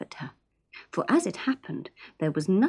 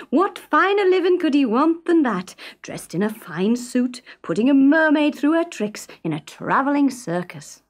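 higher and louder, delighted father; proud boast; 7 semitones higher, 4 dB louder than usual. Acted. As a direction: crow about the grand plan. Fred boasts to family.

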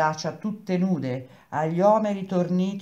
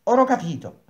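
higher and louder, a triumphant burst; exclaiming; 7 semitones higher, 7 dB louder, faster than usual. A narrator's reading. Metello suddenly grasps the theory, exclaiming louder. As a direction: blurt it out as the idea finally clicks. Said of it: "Lui esclamò".

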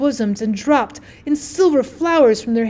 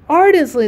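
ARDENTLY higher and louder; intensified repeat; 5 semitones higher, 6 dB louder than usual. A narrator's reading. Narrator on the wife's new desire, emphatic ARDENTLY. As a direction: press "ardently" out with raised pitch and force.

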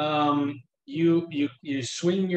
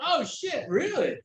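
much higher, startled squeak; high mock surprise; 13 semitones higher than usual. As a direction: yelp it out, startled. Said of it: "you're gonna be like".

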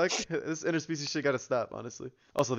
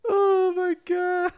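high, drawn-out laughing groan; laughing disbelief; 5 dB louder, much slower than usual. Real, not acted. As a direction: crack up in disbelief.

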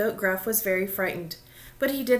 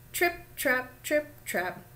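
light, higher-pitched trip-trapping; short, separated little goat steps; little hoofsteps; 4 semitones higher than usual. A narrator's reading. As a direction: tap out the words like small hooves. Little goat's hooves on bridge.

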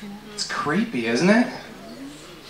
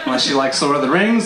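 usual speaking voice

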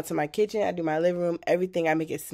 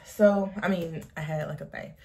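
ordinary delivery

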